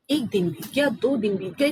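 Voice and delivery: ordinary delivery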